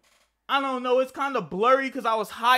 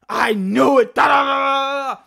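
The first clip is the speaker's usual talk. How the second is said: imitating a mother's loud crying cry, higher; crying shout; 5 semitones higher, 9 dB louder than usual. Mother crying out at her son over drugs, imitated loud sobbing shout. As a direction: wail it out like a heartbroken mom. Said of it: "She's fucking crying like".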